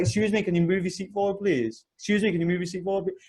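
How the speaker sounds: fast, mechanical repetition; repeated request. Retold or imitated. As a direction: rattle off the request again and again.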